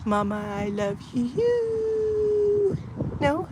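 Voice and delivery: very high, slow cooing voice for the dog. Real, not acted.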